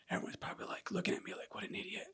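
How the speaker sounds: whispered embarrassed thought; hushed, retold inner shame; self-conscious. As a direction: mutter it almost without voice. Imagined judgement while crying, retold.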